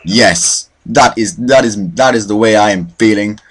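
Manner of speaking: ordinary talk, nothing marked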